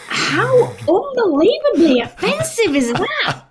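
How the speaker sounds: high, slow, incredulous mock outrage; high drawn-out mock indignation; outraged disbelief. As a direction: act appalled at the insult. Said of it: "And you're like".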